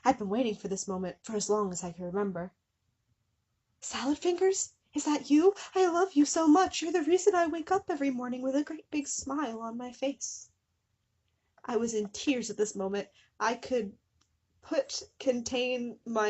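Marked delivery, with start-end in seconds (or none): 3.8-10.5 high, breathless adoring gush; gushing fangirl. Acted. Fan girl gushing to Salad Fingers on phone, high adoring. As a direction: squeal it to your idol. Narrator gushing to her idol.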